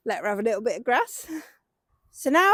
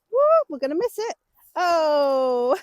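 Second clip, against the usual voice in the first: startled woo; 4 semitones higher, 4 dB louder, slower than usual. Real, not acted. Horse about to miss pole.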